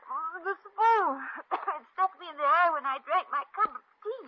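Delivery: high, loud hammed-up wail; distressed excuse. Girl hiding her face behind a napkin, distressed excuse. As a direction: wail it out, high and stretched at first. Pretends spoon stuck in eye.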